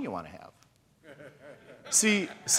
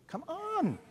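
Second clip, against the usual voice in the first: incredulous come on; 13 semitones higher, 5 dB quieter than usual. Real, not acted. Preacher's incredulous aside.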